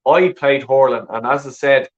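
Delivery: ordinary delivery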